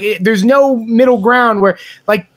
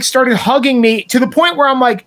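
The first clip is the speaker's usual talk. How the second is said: ordinary delivery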